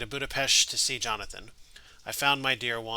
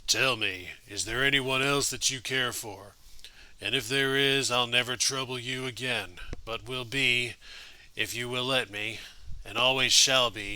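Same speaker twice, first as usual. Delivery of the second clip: a hint of a John Wayne Western accent; Duke cowboy accent. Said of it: "A Spock line in a Duke accent".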